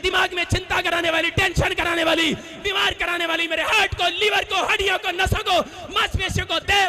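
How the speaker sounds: loud, high commanding rebuke; shouted deliverance prayer. Acted. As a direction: drive the evil spirit out with full force. (ज़ोर से चिल्लाकर आत्मा को डांटना)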